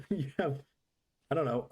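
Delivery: usual speaking voice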